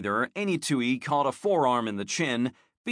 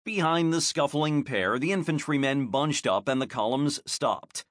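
ordinary delivery, nothing marked

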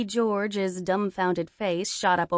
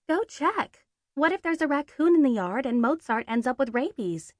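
put-on higher, anxious girlfriend voice; worried girlfriend; 7 semitones higher than usual. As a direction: fret like a nervous girlfriend. Girlfriend frets about the cat.

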